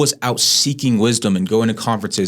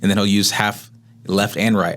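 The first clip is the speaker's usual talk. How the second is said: usual speaking voice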